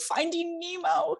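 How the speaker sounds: high, silly coaxing voice to a fish; mock pleading up high, talking to a fish tank. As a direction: coax the fish like a child.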